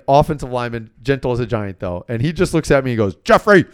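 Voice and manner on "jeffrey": sharp call. Retold or imitated. Big friend cuts him off.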